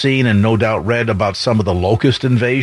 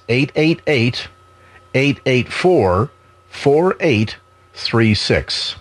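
slow, each digit spelled out; deliberate and crisp, digit by digit; over enunciating; much slower than usual. Shown as a demonstration. Reading phone number carefully.

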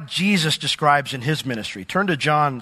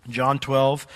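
ordinary delivery

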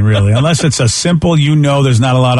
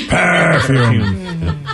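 "perfume" with a long purred PER; copying the demo. Shown as a demonstration. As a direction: echo the drawn-out "PERRR-fume". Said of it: "That's what it is".

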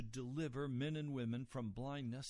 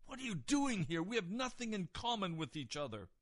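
raised, indignant territorial outburst; angry and disgusted; 6 semitones higher, 4 dB louder than usual. Retold or imitated. Evil spirit confronts Jesus on its territory, angry disgust. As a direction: act out a disgusted, angry spirit defending its turf. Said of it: "utterly angry and disgusted".

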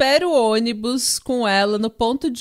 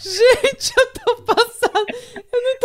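high, laughing through the words; can barely talk for laughing; 10 semitones higher than usual. Real, not acted. Cracking up after the joke.

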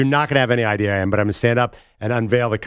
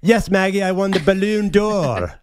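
high, slow bad British accent; hammed-up British accent saying "Balloon d'Or" like the English word balloon; put-on British voice, "Balloon" as in party balloon; George Best; 8 semitones higher than usual. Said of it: "do your bad British accent again".

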